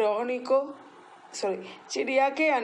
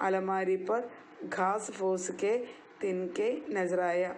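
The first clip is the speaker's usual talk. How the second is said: slow drill. Shown as a demonstration. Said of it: "എൻ്റെ കൂട്ടത്തിൽ അഞ്ച് പ്രാവശ്യം പറഞ്ഞേ".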